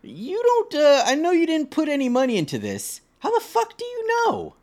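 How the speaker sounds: high, mocking snooty voice; high put-on voice of a rude daughter-in-law. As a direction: squeak out a snotty imitation of the bride. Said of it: "for her to be like".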